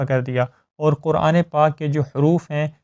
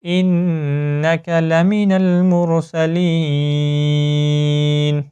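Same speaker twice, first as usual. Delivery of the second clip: slow melodic tajweed chant; Quran recitation; much slower than usual. Shown as a demonstration. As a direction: recite it slowly with ghunna. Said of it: "اس آیت کو دوبارہ سے پڑھیں". (قرآن کی تجوید کے ساتھ آہستہ تلاوت)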